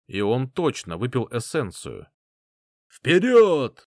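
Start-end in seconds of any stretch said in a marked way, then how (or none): 2.9-3.9 loud, high charge command; battle cry shout. Acted. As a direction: roar the charge forward. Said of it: "крикнул я".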